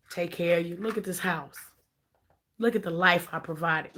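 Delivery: low, soft, coaxing husband guilt-tripping his wife; husband's low manipulative voice. Retold or imitated. Husband pressuring his wife to stay, low and soft coaxing. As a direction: murmur it low like a controlling husband quoting scripture.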